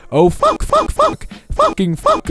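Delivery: high squeaky panicked swearing; panicked cursing. Acted. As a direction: squeal the curses in rising panic. Panicked cursing after blurting secret.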